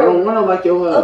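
high, loud insistent yell; angry shouting match. Real, not acted. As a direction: shout it over their replies. Shouting match about her hair.

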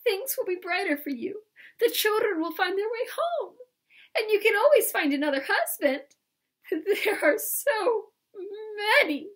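high, hammed-up sobbing voice; broken up by acted sobs, very high; gasping between sobs. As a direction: console someone while crying yourself. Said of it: "she gasped between her sobs".

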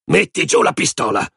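high-pitched, sharp command; commanding bark at an underling; barked order. A narrator's reading. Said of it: "abbaiò".

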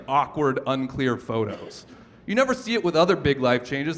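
usual speaking voice

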